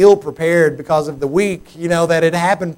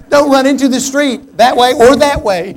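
put-on loud, high warning to running children; retold frantic shout at kids; calling out to kids; 7 semitones higher, 9 dB louder than usual. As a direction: holler it across the yard.

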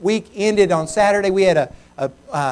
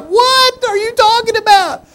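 high, loud, drawn-out disbelief; retold shriek of disbelief; incredulous outburst; 7 dB louder than usual. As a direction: cry it out in stunned disbelief. Retells his shocked reply.

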